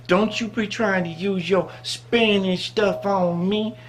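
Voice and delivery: slow, drawn-out imitated scolding; mock warning. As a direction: stretch it out like an affronted woman squaring up.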